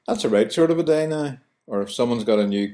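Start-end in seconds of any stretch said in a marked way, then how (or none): 0.1-1.4 higher, cheery Belfast remark; Belfast phrase. Shown as a demonstration. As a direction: say it like a local on a sunny day. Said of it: "we'll say".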